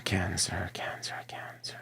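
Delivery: fading, voiceless whisper. Real, not acted. Tarot reader reacting to Cancer's cards, whispering.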